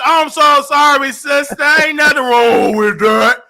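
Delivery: loud, drawn-out, high apology; over-the-top loud reassurance; effusive apology. Real, not acted. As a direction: gush a big, slow sorry. Effusive apology for calling caller brother.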